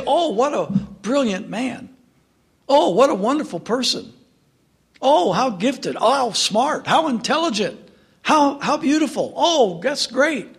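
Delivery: high, starry-eyed praise, mock adoring; gushing admiration. Preacher mocks people praising Satan's messengers, high gushing admiration. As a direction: fawn over him like a fan. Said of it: "You say".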